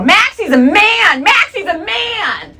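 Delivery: high sing-song schoolgirl taunt; slow, high-pitched mean-girl jeer; taunting bullies. Retold or imitated. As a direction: chant it high and drawn out, mocking. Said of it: "girls would corner me in the bathroom and go".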